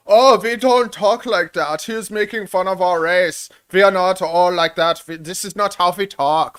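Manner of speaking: put-on loud, high, indignant German accent. Joking about an offended German, in a thick German accent. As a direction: protest in a hammed-up German accent. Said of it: "there's no German saying".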